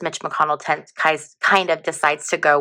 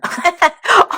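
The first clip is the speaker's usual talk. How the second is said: sudden high-pitched burst of giggling; high giggle; 13 semitones higher, 4 dB louder than usual. Real, not acted. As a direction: giggle in spite of yourself. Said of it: "I should not laugh".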